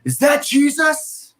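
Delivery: high-pitched, drawn-out mock yell of a little girl; loud child's whisper-yell. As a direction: imitate a kid yelling a supposed whisper, high and slow. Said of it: "whispers to her mom and yells to the whole theater".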